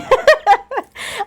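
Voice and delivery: real giggle breaking out high; high burst of laughter. Real, not acted. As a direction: laugh freely at your own slip. Laughs at her own slip.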